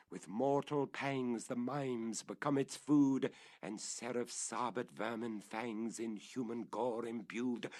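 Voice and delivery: solemn verse reading that builds; dramatic poem recital. A narrator's reading. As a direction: recite it like a dark incantation.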